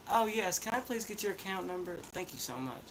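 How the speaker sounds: soft, polite accent-free phone agent; forced accent-free operator voice; no country accent. Shown as a demonstration. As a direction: put on customer-service courtesy without country accent. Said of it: "ain't how I really sound".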